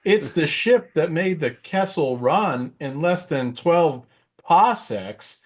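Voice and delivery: high, very slow Walken cadence; Christopher Walken impression. Walken as Han bragging about his ship, slow and high. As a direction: drag out the brag in a Walken rhythm. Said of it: "his Christopher Walken was pretty hilarious".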